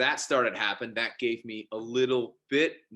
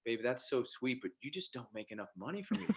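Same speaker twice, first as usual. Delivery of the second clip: sweet wife; 8 dB quieter than usual. Retold or imitated. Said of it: "she's as sweet as could be".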